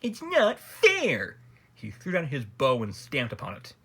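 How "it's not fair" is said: loud, high-pitched, drawn-out complaint; outraged protest. A narrator's reading. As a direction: protest the injustice at full pitch. The Grand Duke's outraged complaint.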